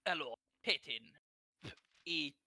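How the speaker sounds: put-on slow Russian accent greeting; lightly done Russian accent. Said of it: "my Russian accent".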